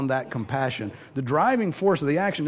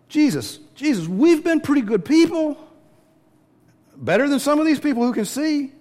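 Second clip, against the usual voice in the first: high, loud self-righteous whine; mock a smug beggar pleading his merit; whiny entitled plea; 11 semitones higher, 6 dB louder than usual. Preacher mocking the entitled asking Jesus, high whiny plea.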